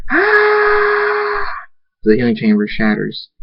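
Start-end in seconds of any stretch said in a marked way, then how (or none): 0.1-1.5 very high sustained scream; long scream. A narrator's reading. Thunderstorm screams as the healing chamber shatters, long high scream. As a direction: hold a piercing high scream. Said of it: "Thunderstorm screams".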